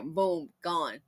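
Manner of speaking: usual speaking voice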